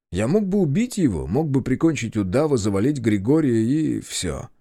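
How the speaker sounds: usual speaking voice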